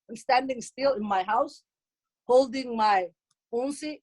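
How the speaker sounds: normal talking voice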